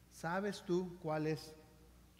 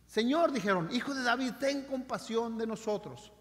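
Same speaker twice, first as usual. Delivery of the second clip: shouting plea; 3 semitones higher than usual. Shown as a demonstration. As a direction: shout it over the crowd. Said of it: "gritaban a Jesús con más fuerza".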